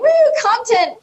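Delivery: put-on drunk cheer. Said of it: "They're like".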